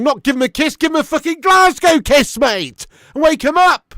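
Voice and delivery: ranting shout. Real, not acted. Fan mocking his team's sleepy play, loud angry rant. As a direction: rant at the players to wake up.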